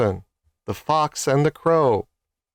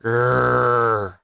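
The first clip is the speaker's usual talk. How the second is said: lion growl. Acted.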